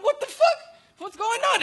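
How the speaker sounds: high-pitched baffled question; acted bewilderment. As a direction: squeak it out in alarmed confusion. Acts out own bewilderment in story.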